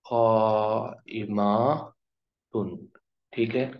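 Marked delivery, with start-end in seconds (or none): 0.0-1.9 قائماتن stretched very slowly; slow teaching pronunciation; slow model word. Shown as a demonstration. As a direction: draw out قائماتن. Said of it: "خبر کیا ہے".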